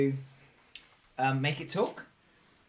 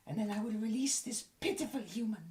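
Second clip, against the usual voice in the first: high, softer goblin voice; bargaining goblin; 7 semitones higher, 7 dB quieter than usual. Acted. Goblin offering its gods the prisoner, squeaky bargaining. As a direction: drop from the shriek to a quieter squeaky offer. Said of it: "The goblin up here immediately stops".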